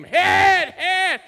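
high, loud repeated order, tapering off; retold scream. Deaf old man screaming at the pastor to hit, loud and high. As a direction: yell it twice, pushing him to swing. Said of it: "He'd scream".

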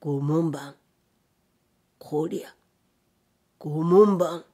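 low, resounding ghostly call; eerie put-on voice. A narrator's reading. Hidden samurai calling the gatekeeper in the night, eerie disguised voice. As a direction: drone the call low, swelling on the last word. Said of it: "退屈男の同じ不気味な声色が".

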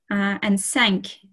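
normal talking voice

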